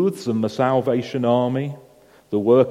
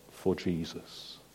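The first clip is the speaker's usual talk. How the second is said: soft vow; 6 semitones lower, 16 dB quieter, slower than usual. A narrator's reading. Mother's vow ending, softly.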